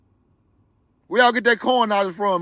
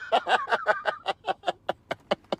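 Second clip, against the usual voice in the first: high, screaming laugh; screaming with laughter; shrieking laugh; 9 dB quieter than usual. Real, not acted. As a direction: let out a shrieking howl of laughter. Cracking up at the claims.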